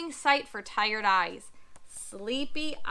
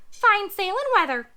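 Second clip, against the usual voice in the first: high cheery parrot; loud high bird chirp; 7 semitones higher, 8 dB louder than usual. Acted.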